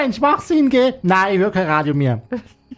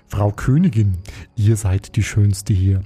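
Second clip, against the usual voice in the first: lightly put-on Prussian German, lower voice; a stiff Prussian-accented mirror, spoken lower; 5 semitones lower than usual. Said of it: "da hat der Preuß wieder".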